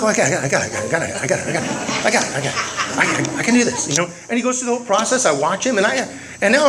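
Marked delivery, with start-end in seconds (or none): 0.0-3.9 laughing. Retold or imitated. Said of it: "I'm like".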